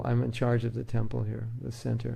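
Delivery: normal talking voice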